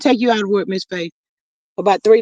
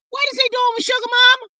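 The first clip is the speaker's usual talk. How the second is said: outraged shout; 13 semitones higher, faster than usual. Real, not acted. Outraged at ex's post.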